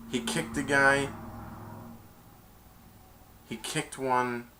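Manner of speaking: ordinary talk, nothing marked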